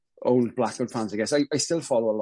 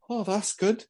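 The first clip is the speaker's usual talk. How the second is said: high-pitched, softer retold delight; impressed reaction; 8 semitones higher, 4 dB quieter, slower than usual. Recalling first hearing the album, impressed. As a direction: re-enact being instantly won over, pitched high. Retelling his first excited listen.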